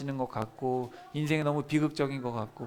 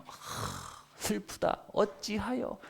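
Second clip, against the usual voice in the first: sighing lament; 8 semitones higher, 6 dB quieter, much slower than usual. Shown as a demonstration. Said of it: "에카가 뭐냐면".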